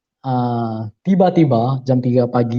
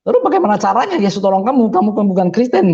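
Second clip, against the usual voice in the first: higher, quick incredulous question; baffled questioning, pitched up; retold puzzled challenge; 8 semitones higher than usual. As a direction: quote yourself asking in disbelief. Retold puzzled challenge about religion.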